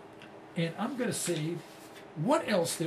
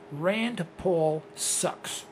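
each word spaced out, very slow; slow and deliberate; much slower than usual. Real, not acted. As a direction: spell it out so nobody mistakes it. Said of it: "Let me say this again so you don't misunderstand me".

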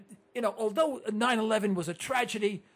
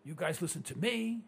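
ordinary talk, nothing marked

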